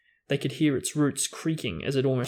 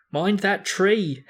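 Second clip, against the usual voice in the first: higher, slow, forceful bellow; 7 semitones higher, 4 dB louder, slower than usual. Acted. Harry lunging for the wheel, bellowed warning. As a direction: warn him with everything you have. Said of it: "Harry bellowed".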